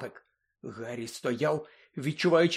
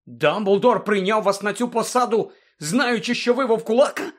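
high, loud incredulous question; can't believe his ears; astonished; 8 semitones higher, 7 dB louder than usual. A narrator's reading. Ron to Lupin, incredulous, high and loud. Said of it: "здивувався Рон".

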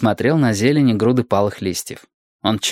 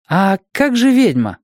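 put-on high child's question; high-pitched inquisitive child; eager little boy; 8 semitones higher, 3 dB louder than usual. A narrator's reading. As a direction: ask insistently as a curious boy. Boy asks about the witch.

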